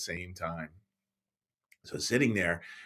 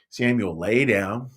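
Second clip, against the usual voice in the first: usual speaking voice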